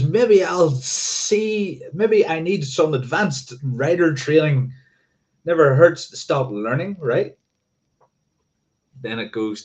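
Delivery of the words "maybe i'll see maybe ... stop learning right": high, drawn-out tongue-in-cheek reading; mock-musing read-aloud. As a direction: read it high with exaggerated, slowly swooping pondering. Reads own joking post aloud.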